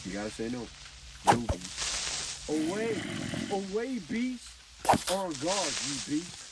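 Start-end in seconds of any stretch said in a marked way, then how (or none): 1.2-6.4 defiant shout. Acted. Defying the Beast.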